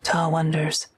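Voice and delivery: ordinary delivery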